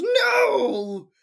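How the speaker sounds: very high, drawn-out cry of dismay; imitating a distraught, shrieking outburst; mock wail. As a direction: wail it in a hammed-up falsetto. Retold movie character's outburst.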